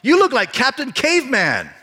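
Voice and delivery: high, loud mocking yell across the class; kid yelling. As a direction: shout the insult to get laughs. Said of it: "another kid yelled out".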